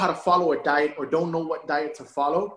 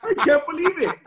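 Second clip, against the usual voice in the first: high, laughing gasp of disbelief; stunned by yourself in the mirror; amazed disbelief; 9 semitones higher, 3 dB louder, slower than usual. Pretend. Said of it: "look at yourself one day in the mirror and go".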